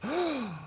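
sharp high gasp of shock; retold gasp. Crowd gasping as new kid refuses bully, sharp gasp. Everybody gasps at retold scene.

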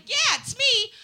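high, loud hammed-up star voice; mock pop-star self-introduction. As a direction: announce yourself up high, stretching words. Playing along as [name].

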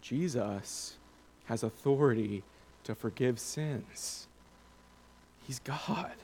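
slow, soft, weighty delivery; hushed and deliberate, sinking to a near-whisper; hushed emphasis. Real, not acted. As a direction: drop the voice right down for the key point. Preacher's hushed point after long pause.

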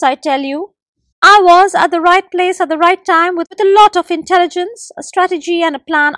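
loud, high bragging gecko; boasting gecko. A narrator's reading. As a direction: brag about your cleverness. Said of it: "boasted the forest gecko".

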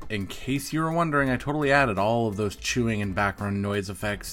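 upbeat host sign-off read; podcast outro. Acted. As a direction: read it like a radio host closing the show.

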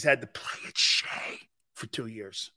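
hushed, voiceless whisper. Real, not acted. Fan recalling the Yankees' exile at Shea, whispering. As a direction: drop suddenly into a confidential whisper. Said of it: "I don't know why I whispered".

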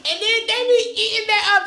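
high laugh into words. Real, not acted. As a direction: laugh through the line. Laughing after the tease.

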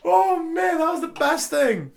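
high-pitched, gushing outburst; delighted exclamation. Real, not acted. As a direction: burst out high over the best thing ever. Exclaiming over a funny video.

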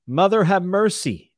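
high, loud cry to the Mother; prayer. Acted. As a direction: call out the prayer in anguish. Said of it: "Davos prayed".

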